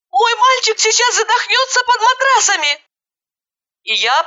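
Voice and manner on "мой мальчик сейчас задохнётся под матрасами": very high-pitched frantic cry; panicked cry. A narrator's reading. Mother fearing her boy will suffocate, panicked. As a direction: cry out in high, wild alarm. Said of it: "закричала я".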